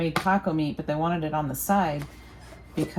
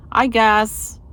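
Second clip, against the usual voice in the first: "I guess" said again higher and louder, a resigned outburst; louder repeat; 6 semitones higher, 7 dB louder than usual. Real, not acted. Dreading cooking dinner, she repeats it louder and higher. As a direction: punch "I guess" up, half-exasperated.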